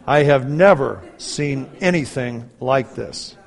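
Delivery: slow and deliberate, "never" and "anything" punched; baffled emphasis. Retold or imitated. Surgeon reports to parents, slow baffled emphasis. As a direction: space it out, rising on "never" and "anything".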